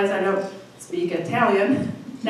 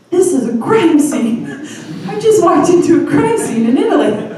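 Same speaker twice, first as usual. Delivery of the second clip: loud, high, alarmed outburst; hammed-up freak-out, voice rising; retold panic; 6 semitones higher, 8 dB louder than usual. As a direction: blurt it out in comic horror. Retold panic at bloody lobby.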